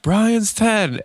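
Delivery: high-pitched mock crowd yell, not full volume; retold crowd scream. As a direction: imitate hundreds yelling a punchline. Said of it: "three hundred plus people literally all scream".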